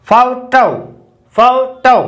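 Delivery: फोटो said carefully, fo-to with clear o; फोटो spoken slowly as in English photo; correct pronunciation photo. Shown as a demonstration. Teacher modelling फोटो for Hindi-speaking students. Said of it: "आप लिखते इस प्रकार से हो फोटो".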